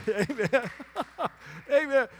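real chuckle around the word; laughing amen. Real, not acted. Preacher rejoicing that the pianist got saved, laughing. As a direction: laugh between the amens.

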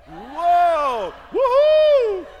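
loud, high whooping cheer; excited whoop. Real, not acted. Pastor cheers about sons-in-law, loud and high whoop. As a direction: shout for joy.

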